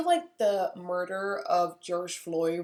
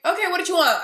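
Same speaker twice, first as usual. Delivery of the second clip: much higher and louder call back across the store; retold shout; 10 semitones higher, 8 dB louder, faster than usual. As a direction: yell back with an edge. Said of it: "I screamed from across the room".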